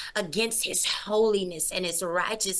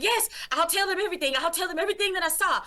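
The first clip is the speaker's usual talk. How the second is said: high, fast, breathless promise; frantic promising; 8 semitones higher, faster than usual. Retold or imitated. Man rushing to promise Jesus everything, high and fast. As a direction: rush the promise out, laughing nervously.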